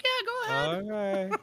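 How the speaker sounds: high, dismissive doctor; goofy high voice. Retold or imitated. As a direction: brush him off in a squeak. Voicing the doctor.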